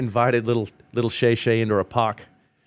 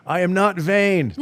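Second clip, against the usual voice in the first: higher, drawn-out protest of vanity; mock indignation; 7 semitones higher, slower than usual. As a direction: protest grandly, tongue in cheek. Joking mock denial of vanity.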